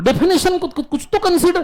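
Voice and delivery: exasperated scolding. Real, not acted. Teacher scolds class for mistake.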